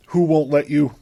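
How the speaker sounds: ordinary talk, nothing marked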